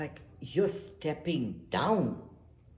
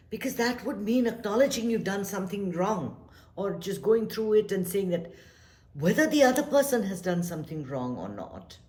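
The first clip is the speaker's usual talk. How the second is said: usual speaking voice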